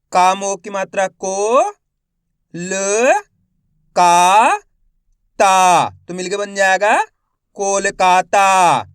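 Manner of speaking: slow spelling drill, each syllable held with a rising end; stretched syllables for beginning readers; letter-by-letter sounding out. Shown as a demonstration. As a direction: sound out each letter, then blend the word. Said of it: "एक एक अक्षर का उच्चारण कर रहा हूँ".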